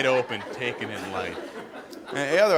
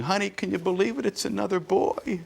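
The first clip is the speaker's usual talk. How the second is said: crying wife; 8 semitones higher than usual. Retold or imitated. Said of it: "she'd be crying and going".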